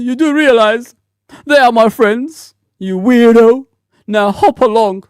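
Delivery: lightly imitating a prissy little girl. Mocking a troll's reply, girlish and prim. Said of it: "like a little girl".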